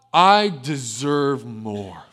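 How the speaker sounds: slow, drawn-out entitled voice; greedy inner voice. Retold or imitated. Adam and Eve eyeing their blessings, slow and entitled. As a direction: stretch it out like a selfish whisper of pride. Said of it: "and they thought".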